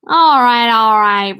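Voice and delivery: loud, mock-exasperated surrender; laughing cry. A narrator's reading. Richie gives in to his teasing friends, crying out loudly. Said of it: "Richie cried, laughing himself".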